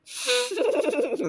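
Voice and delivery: high-pitched giggling fit; helpless high giggle at own story. Real, not acted. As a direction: crack up in a high, rapid giggle. Cracks up at his own story.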